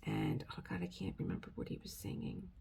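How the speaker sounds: whispered aside while holding back tears. Real, not acted. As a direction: let it drop to a whisper, overcome.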